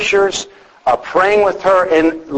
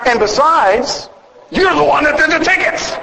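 mock indignant shout; 10 semitones higher than usual. Husband jokingly yells at his wife over the tickets, hammed-up indignant shout. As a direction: throw the blame back with a mock shout.

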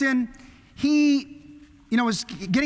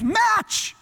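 sharp, much higher shouted demand; urgent shout; 12 semitones higher, 4 dB louder than usual. Retold or imitated. Captain shouting at his crew for a match. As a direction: yell it over the sinking ship. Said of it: "And he goes".

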